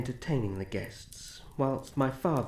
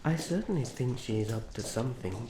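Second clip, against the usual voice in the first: usual speaking voice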